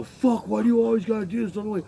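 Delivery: imitating an annoyed boss; mock annoyed complaint. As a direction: mimic the boss griping. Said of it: "he'd go".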